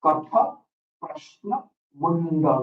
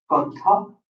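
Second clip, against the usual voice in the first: ordinary delivery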